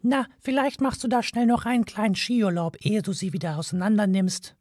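high, sarcastic mouse; a tiny mouse mocking him; high mouse voice, caustic; biting mouse voice. A narrator's reading. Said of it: "sagte Frankie bissig".

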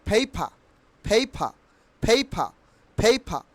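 "পেপার" as phei-pha, silent r; corrected pronunciation. Shown as a demonstration. Teacher answering learners, "পেপার" as phei-pha. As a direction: puff both p's in "পেপার", drop the r. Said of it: "পেপারকে আমরা কিভাবে উচ্চারণ করব".

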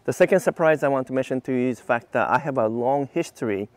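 normal talking voice

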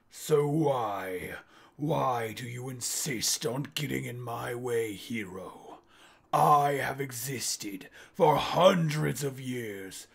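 slow, grand villain monologue; villain speech. Retold or imitated. Villain lecturing the hero before the fight, slow and grand. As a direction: loom over the hero, drawing every word out. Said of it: "he's giving his speech".

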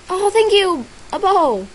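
pleased with the bow; delighted thanks. Real, not acted. Thanking a player for a bow, delighted.

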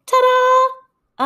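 high loud showman reveal; showy ta-da. Acted. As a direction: present it like a magician. Fox reveals the egg.

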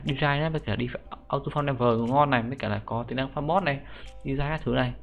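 normal talking voice

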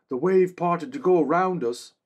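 high, earnest soldier's voice; a loyal guard reporting to his king; young man-at-arms. Acted. Another man, loud and high.

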